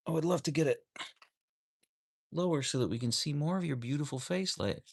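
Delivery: high, sweet coaxing voice; sweet pet talk. Real, not acted. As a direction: coo it to your dog. Coaxing the dog on camera.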